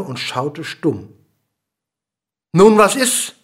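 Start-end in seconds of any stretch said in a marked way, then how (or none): 2.5-3.3 loud, high questioning call. A narrator's reading. King asks the dwarf who has not jumped, calling out. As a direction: call the question up sharply to a rising end. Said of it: "rief der König".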